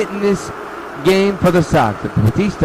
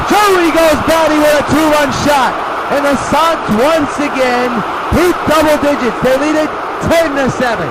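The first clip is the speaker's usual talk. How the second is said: home run call; 5 semitones higher than usual. Pretend. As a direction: call it like the ball is leaving the park. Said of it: "the home run call".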